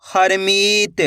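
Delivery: slow, stretched reading of the whole word; joined-up word. Shown as a demonstration. Teacher showing learners the finished word, slowly joined up. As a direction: blend the syllables into one drawn-out word. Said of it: "इनको आपस में लाके पढ़ना है".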